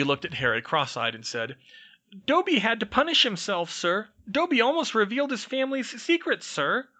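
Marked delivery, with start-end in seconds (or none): 2.3-6.9 very high, loud squeaky elf voice, urgent; a tiny servant pleading a warning; anxious house elf. Acted. House elf warns Harry, squeaky and urgent. Dobby's voice held.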